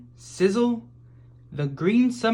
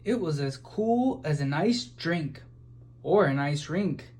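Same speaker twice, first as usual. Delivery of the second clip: usual speaking voice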